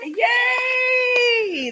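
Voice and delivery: high, loud happy yay; squealed yay; excited cheer. Real, not acted. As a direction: cheer like good news just landed. Cheering the arrived books.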